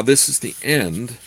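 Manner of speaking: ordinary delivery, nothing marked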